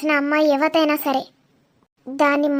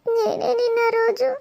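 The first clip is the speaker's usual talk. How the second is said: crying, breaking voice, pleading with an absent lover; tearful lament; 9 semitones higher than usual. Acted. Abandoned woman begs her lover Dheeru not to leave, crying. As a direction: let the sobs break through the words.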